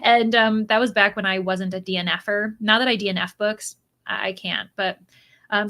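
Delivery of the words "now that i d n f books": normal talking voice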